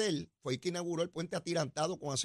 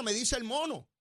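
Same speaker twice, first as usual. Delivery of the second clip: ordinary delivery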